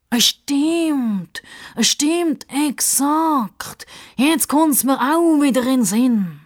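high, drawn-out exclamations; excitable sidekick Justus. A narrator's reading. Sidekick suddenly remembering, high and excited. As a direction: chime in grandly, stretching each word.

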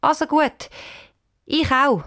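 put-on hesitant child going along; half-convinced. A narrator's reading. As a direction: give in without much conviction. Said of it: "Die Anisa sagt mäßig überzeugt".

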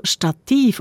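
Stativ stressed on final -TIV; stress on second syllable. Shown as a demonstration. Host building up the listener's question, Stativ stressed on -tiv. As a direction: put the accent at the end of Stativ. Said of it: "auf der zweiten Silbe betont".

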